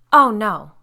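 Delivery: sudden loud "oh no"; alarmed. A narrator's reading. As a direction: blurt it out in alarm.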